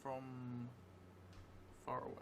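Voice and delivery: slow, drawn-out in-character telling, often hushed; slow story narration. Acted. Vampire player dictating a new memory to the audience, slow hushed narration. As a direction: spin the vampire's memory word by word.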